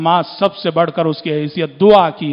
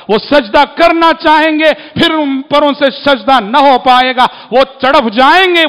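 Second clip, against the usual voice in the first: louder and higher, fiery preacher's cry; preaching at full force; 7 semitones higher, 6 dB louder than usual. Acted. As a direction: thunder it out to the congregation. Sermon on the sinners' anguish.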